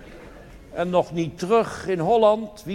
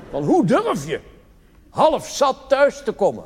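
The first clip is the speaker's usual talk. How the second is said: shrill scolding, pitched up and louder; angry wife; 8 semitones higher, 5 dB louder than usual. Acted. Wife scolds her drunk husband at the door, angry. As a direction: scold him for coming home half drunk.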